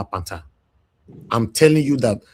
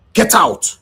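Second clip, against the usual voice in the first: higher-pitched emphatic order; angry dismissal; 10 semitones higher, 4 dB louder than usual. Pretend. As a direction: throw the tenant out, voice raised in pitch. Landlord ordering non-paying tenants out.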